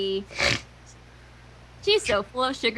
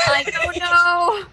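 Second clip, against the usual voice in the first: slow, louder mock crying; mock wail; 5 semitones higher, 6 dB louder, much slower than usual. Friend hamming despair at Pinky having sense, slow mock wail. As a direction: wail it like a ham tragedian.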